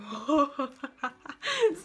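high, loud burst of laughter; giddy laugh. Real, not acted. Vlogger finally getting Makeup Geek, giddy laugh. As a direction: break into an overexcited cackle.

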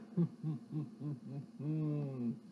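low rumbling bear laugh; a low menacing bear laugh; bear chuckle. Acted. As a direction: chuckle deep like a big bear.

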